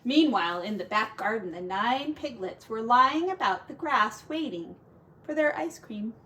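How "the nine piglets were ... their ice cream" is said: ordinary delivery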